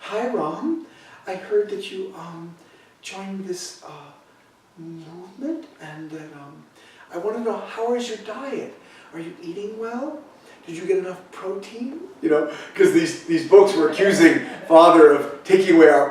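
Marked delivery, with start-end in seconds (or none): 0.0-12.0 high, soft, timid motherly worry; a scared mom checking on her son by phone; mother's worried voice. Retold or imitated. Mother phoning her son, voiced high and softly worried. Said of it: "really scared on the phone".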